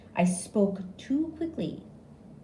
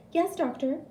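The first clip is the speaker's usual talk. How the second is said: high nurse voice; lightly voiced nurse; nurse's voice; 10 semitones higher than usual. A narrator's reading. Nurse answering the doctor, high voice.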